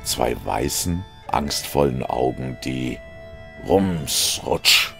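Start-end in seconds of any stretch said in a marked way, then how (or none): none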